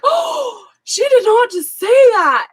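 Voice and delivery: Oh, she did not just say that, high and appalled; shocked disbelief. Retold or imitated. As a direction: play it outraged and amazed. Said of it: "I was like".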